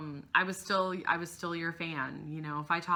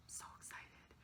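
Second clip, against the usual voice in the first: whisper; 21 dB quieter than usual. Real, not acted. Gushing over photo with Jimmy.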